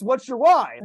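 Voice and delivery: high, loud mock yell; retold screaming. Instructor screaming in his face, retold yell. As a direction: mimic the shouted question, not at full force. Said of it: "Somebody screaming in my face".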